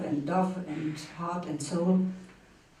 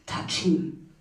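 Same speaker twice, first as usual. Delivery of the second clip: dramatic poem recitation; 6 semitones higher, 4 dB louder than usual. Acted. Reader mocking the bourgeois male in verse, emphatic dramatic recitation.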